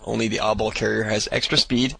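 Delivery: ordinary delivery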